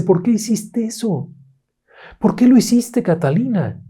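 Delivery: high, scolding mother's voice; the upset mom demands an answer; mother scolding her little girl, high and sharp; angry mother. A narrator's reading.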